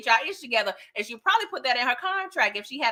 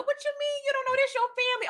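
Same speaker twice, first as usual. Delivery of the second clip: squeaky disbelieving question, pitched way up; high incredulous voice; 14 semitones higher, 4 dB quieter, faster than usual. Retold or imitated. Recapping Franklin's talk, squeaky high disbelief. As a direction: squeal it in outrage. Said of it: "Franklin was like".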